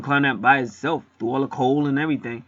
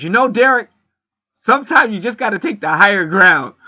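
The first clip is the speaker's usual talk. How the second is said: Michael's voice; 8 semitones higher, 6 dB louder than usual. Retold or imitated. Michael calmly advising Derek.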